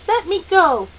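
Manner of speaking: sharp. Acted. Boy orders Meg to release him, sharply. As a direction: bark at her to let go. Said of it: "Charles said sharply".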